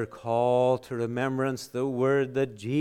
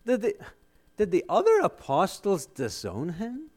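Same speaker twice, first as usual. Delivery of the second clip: normal talking voice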